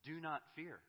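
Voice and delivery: God squaring up to the fearful, forceful; commanding challenge. Retold or imitated. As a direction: stand over them and command it. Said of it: "He stands in front of us and says".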